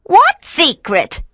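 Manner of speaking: high, slow witch voice; witch feigning ignorance. A narrator's reading. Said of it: "said the witch".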